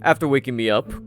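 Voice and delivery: ordinary delivery, nothing marked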